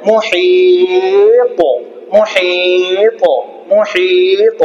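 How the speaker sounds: "মুহিত" with a very long "হি" and bounced final stop; qalqalah demo. Shown as a demonstration. Teacher modelling the word for pupils, drawn-out recitation. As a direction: slowly hold the middle of "মুহিত", then spring off the end. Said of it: "উচ্চারণ কি হবে".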